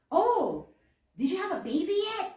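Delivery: prying, sing-song mock question; nosy cashier. Retelling the cashier prying at the checkout, in a high mock voice.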